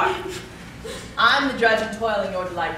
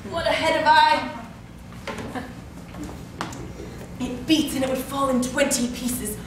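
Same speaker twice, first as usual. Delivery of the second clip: aching complaint; 3 semitones higher than usual. Acted. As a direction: groan through the aches. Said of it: "how my head aches".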